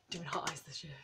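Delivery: whispered, fingers crossed; hushed hope. Real, not acted. Whispering her hope he skates this year. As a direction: breathe it out like a secret wish.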